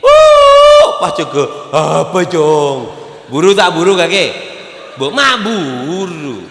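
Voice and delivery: loud, high hammed-up cry of grief; mock wailing mourner. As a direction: play the grieving child, overdone. (meniru orang meratap berlebihan)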